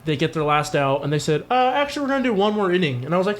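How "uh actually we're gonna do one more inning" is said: high, fast mock announcement; squeaky retold ruling, higher; sing-song fake official voice; mock ump voice. Retold ump changing the rules.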